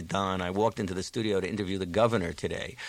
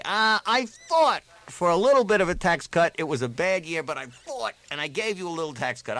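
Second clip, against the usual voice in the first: high, loud, declamatory legislator; boasting legislator; 6 semitones higher, 5 dB louder than usual. Acted. Legislator boasting to voters about a tax cut, declamatory. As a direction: play up the fight, punching the big words high. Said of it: "And I'd say".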